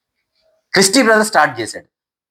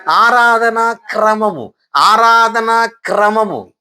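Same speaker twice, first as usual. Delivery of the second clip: ఆరాధనా క్రమము drawn out slowly, each syllable spelled out; slow teaching emphasis; 4 semitones higher, much slower than usual. Shown as a demonstration. Preacher slowly spelling out the term for the congregation. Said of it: "దాని పేరేంటి?".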